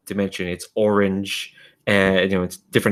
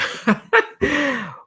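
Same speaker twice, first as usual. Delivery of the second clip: high-pitched gloating cackle; villain's laugh. Acted. Otherworldly voice laughing inside the player's mind, amused cackle. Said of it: "you hear this voice just laughing".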